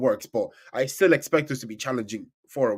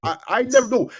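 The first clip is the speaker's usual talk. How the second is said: flustered protest; 8 semitones higher, 5 dB louder, faster than usual. Real, not acted. Flustered defending his ranking.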